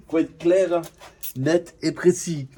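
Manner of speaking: hamming it up in goofy mode; silly mode. Pretend. Filming a goofy car video, mock solemn. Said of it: "je suis en mode couillon".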